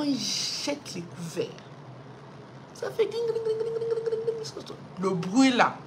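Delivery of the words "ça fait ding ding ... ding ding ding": high, light ding-ding imitation; cutlery clinking. Telling the church how the cutlery rang, high imitation. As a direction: ring it like falling forks.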